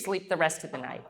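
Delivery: ordinary talk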